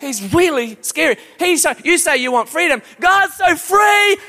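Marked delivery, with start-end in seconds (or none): 3.0-4.2 high, drawn-out cry on free; rising preacher shout. Real, not acted. Preacher crying out to the church, high and stretched.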